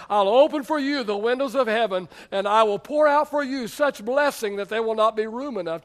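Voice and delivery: loud, forceful preacher quoting scripture; God's proclamation. Acted. Pastor repeats God's promise to tithers, loud proclamation. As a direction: ring out the promise with emphasis.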